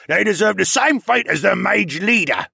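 loud, high, vengeful shout; angry polar bear. A narrator's reading. Polar bear demanding punishment, shouting angrily. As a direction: shout a verdict at the defenders.

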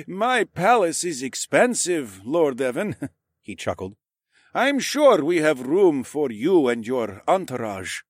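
slow, amused nobleman; a hint of a chuckling lord; jovial lord. A narrator's reading. Narrator voices Lord Calvin.

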